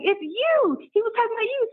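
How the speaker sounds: high, thrilled counselor; light up, you've found her; excited realization. Retold or imitated.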